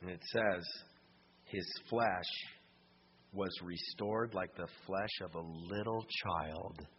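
hushed storytelling. Acted. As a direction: draw them in, almost under your breath. Quiet climax of story.

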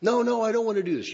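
high, fast whining protest; mock reluctant whine. Preacher mocking a Jesus who loses the fight, lightly whining. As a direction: play the unwilling son, pleading. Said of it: "after losing a fight with God".

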